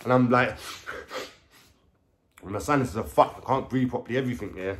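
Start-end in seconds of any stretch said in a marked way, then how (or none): none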